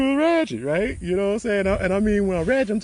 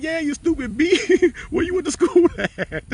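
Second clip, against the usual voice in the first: high retold jeer broken by laughs; laughing mock scorn; 4 dB louder than usual. Friend mocking his schooling, high laughing mock scorn. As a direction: mimic a scornful friend while cracking up. Retold insult, teller cracking up.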